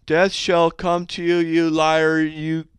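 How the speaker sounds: loud, high, drawn-out delivery; another wrong reading. Shown as a demonstration. Host tries a death threat another wrong way, loud high drawl. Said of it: "Or you could try it like this".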